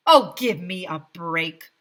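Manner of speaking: scoffing, fed-up tone; mayor scoffing; exasperated. A narrator's reading. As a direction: roll your eyes at them. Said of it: "Mayor Hubble said".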